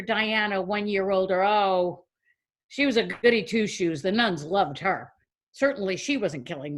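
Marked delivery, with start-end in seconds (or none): none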